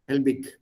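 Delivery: Helbig ending on a hard k; Swiss dialect pronunciation. Shown as a demonstration. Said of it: "We won't use the Swiss pronunciation".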